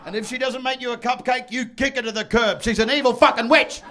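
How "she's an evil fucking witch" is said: usual speaking voice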